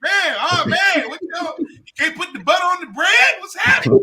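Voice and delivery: high, loud hammed-up concern; Anthony Anderson teasing. Comic imitating his friend ribbing him, high loud mock concern. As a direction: pile on incredulous questions, pitched high. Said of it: "Anthony Anderson was killing me".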